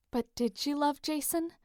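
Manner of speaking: ordinary delivery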